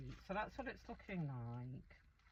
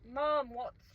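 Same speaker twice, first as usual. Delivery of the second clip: louder, higher call to mom; retold shout; 6 semitones higher, 8 dB louder than usual. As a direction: holler through to mom in imitation. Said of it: "she's shouting".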